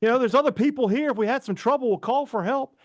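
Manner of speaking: high-pitched, pleading retold argument; protesting kid. Retold protest to panicking mom.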